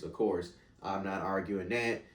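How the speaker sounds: ordinary talk, nothing marked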